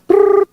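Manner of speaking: high rolled prrr like a ringing phone; playful ringing-phone noise; phone ring imitation.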